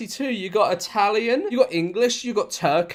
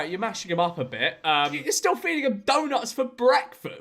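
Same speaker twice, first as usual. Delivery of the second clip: normal talking voice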